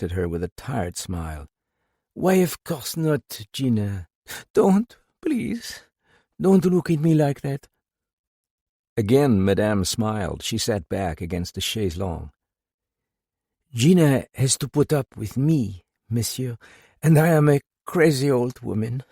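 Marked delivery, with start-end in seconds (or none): 2.1-7.7 put-on higher pleading older woman. A narrator's reading. Madame soothes, then pleads. 13.7-19.0 put-on higher, louder distraught older woman. A narrator's reading. Madame explains herself to visitor.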